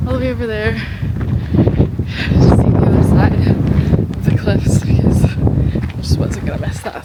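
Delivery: panting, breathless after a sprint; out of breath. Real, not acted. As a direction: talk while catching your breath. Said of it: "I'm really out of breath right now".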